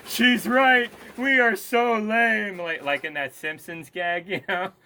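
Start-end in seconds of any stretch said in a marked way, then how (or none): 0.1-2.6 high, loud mocking voice for Javier. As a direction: squeal it out like a sheepish Fremen leader. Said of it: "Javier chime in and be like".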